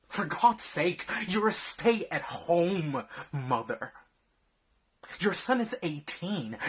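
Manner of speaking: loud angry rebuke; angry shouting. Acted. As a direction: yell it at her in disgust. Berating wife for slacking.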